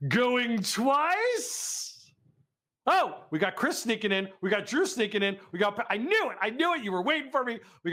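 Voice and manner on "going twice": drawn-out, rising auctioneer call; auctioneer's call. Pretend. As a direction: stretch the last word high.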